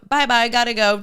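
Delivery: ordinary delivery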